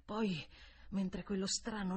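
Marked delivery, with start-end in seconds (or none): none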